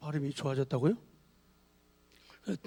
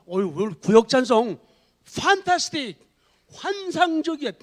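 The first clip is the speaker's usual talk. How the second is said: bright, rising "fantastic, 환상적이야" cheer; cheerful praise; 4 semitones higher, 3 dB louder than usual. Retold or imitated. Churchgoer praising the group's hymn after service, excited. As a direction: lift them up with delighted praise. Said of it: "끝나고 나면".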